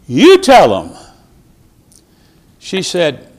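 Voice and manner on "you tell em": louder, forceful "You tell 'em"; a mock loud version of the pastor's instruction; loud command. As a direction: bark it out as a blunt order. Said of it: "he didn't say it in that tone of voice".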